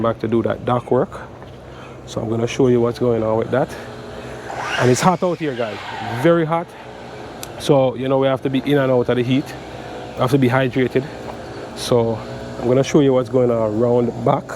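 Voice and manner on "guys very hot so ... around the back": ordinary talk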